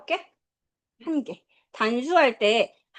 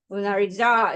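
"모나리자"의 "자"에 강세; "모나리자"의 마지막 "자"를 세게; 끝음절 강세; 4 semitones lower, slower than usual. Shown as a demonstration. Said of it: "네 번째". ("모나리자"의 "자"에 힘주어)

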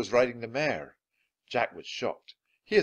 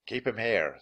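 ordinary delivery, nothing marked

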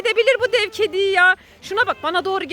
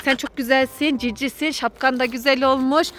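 lower, sweet coaxing talk; coaxing the cat; 8 semitones lower than usual. Pretend. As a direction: charm the cat into calming down. Sweet-talking the giant cat. (kediyi tatlı dille, alçak sesle kandırma)

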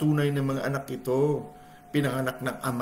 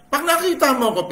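voicing Jesus; 14 semitones higher, 8 dB louder than usual. Jesus speaking to Felipe.

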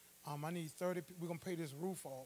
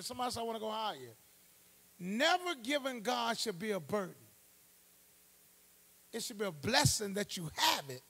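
loud, high, driving sermon cry; preaching at full force; 4 semitones higher, 6 dB louder than usual. Acted. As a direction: preach it hard to the congregation.

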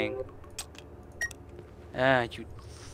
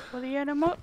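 mock battle cry; 13 semitones higher, much faster than usual.

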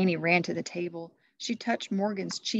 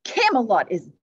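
big louder pronouncement; booming; 3 semitones higher, 11 dB louder than usual. Acted. Christmas Knight warning King Arthur's court, booming. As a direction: boom it across the hall. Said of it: "the Christmas Knight boomed".

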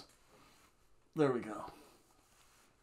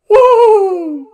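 shrill, very high, loud woo; Ric Flair woo; 20 dB louder than usual. Retold or imitated. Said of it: "Pulled a Ric Flair".

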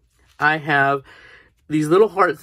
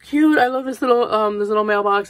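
excited; 7 semitones higher than usual. Retold or imitated. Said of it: "I was like".

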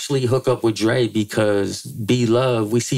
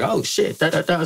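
high startled oh shit; mock his stunned reaction; imitating Frank's surprise; 8 semitones higher than usual. Publisher hearing the demand, high startled mimicry. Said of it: "Frank's like".

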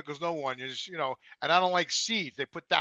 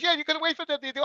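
mock worker voice; 11 semitones higher, faster than usual. Imitating drive-through worker.

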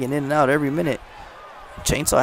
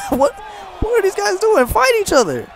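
incredulous shouting. Real, not acted. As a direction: egg them on, baffled. Laughing at the stalling.

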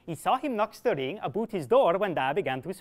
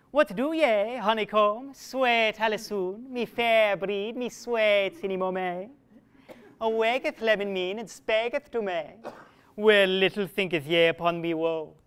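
wooing lover; 3 semitones higher, 3 dB louder than usual. Acted. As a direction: croon up to her window, pleading. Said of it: "Full soft he cougheth".

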